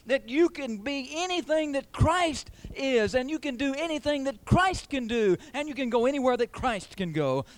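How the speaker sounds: high, rousing pulpit declaration; fervent preaching. Real, not acted. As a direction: lift each promise up high to the congregation. Preacher rises to full force.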